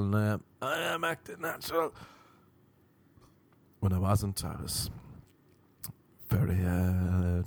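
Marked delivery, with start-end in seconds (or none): 0.2-1.9 high, strained protest; tensed up. Retold or imitated. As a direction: insist through a tightly tensed body. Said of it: "I was tensing so much".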